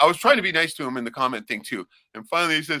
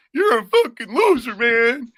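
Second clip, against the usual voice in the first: mock taunt; 7 semitones higher, 7 dB louder, slower than usual. Acted taunt to a quitter.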